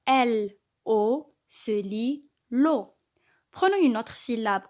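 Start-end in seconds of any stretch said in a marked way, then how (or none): 0.1-2.9 L A se lit la spelled out slowly; slow, deliberate classroom decoding; slow sounding-out. Shown as a demonstration. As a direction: spell la out letter by letter. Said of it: "Nous avons vu la syllabe lo".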